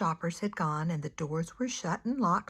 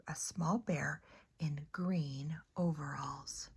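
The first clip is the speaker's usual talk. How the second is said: gentle storybook narration, hushed at phrase ends; soft storytime voice; reading to children; 2 semitones lower, 4 dB quieter than usual. A narrator's reading. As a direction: read it like a bedtime picture book. Reads picture book to children.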